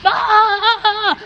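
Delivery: high, loud mock wail of a locked-out kid; imitated kid wailing. Dad imitates his son locked outside, high mock wailing. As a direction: wail like a little boy banging on the door. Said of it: "knocking on the door and, and banging".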